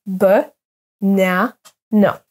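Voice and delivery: "B, na, na" as buh... NA... na with schwa first; syllable by syllable. Shown as a demonstration. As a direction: separate each piece of "B, na, na" with pauses. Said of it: "Donc, on prononce".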